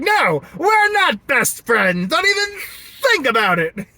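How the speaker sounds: loud, high, drawn-out mock protest; mock refusal. Driver replaying his first reaction to work best friend, mock shouted refusal. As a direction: shout it down, hammed-up. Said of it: "Me at first, I was like".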